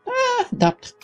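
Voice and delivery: ordinary delivery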